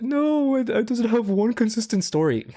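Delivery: mock him in a high, silly little voice; mocking gnome voice. Streamer mocking a critic's take, high squeaky parody voice. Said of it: "This fucking gnome is like".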